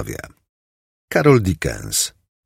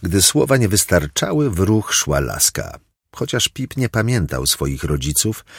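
usual speaking voice

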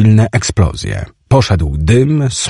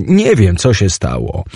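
ordinary delivery, nothing marked

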